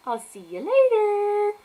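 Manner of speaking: high, slow, stretched-out farewell; playful drawn-out goodbye; sing-song goodbye. Real, not acted. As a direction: sing out the sign-off loudly. Playful sign-off to viewers.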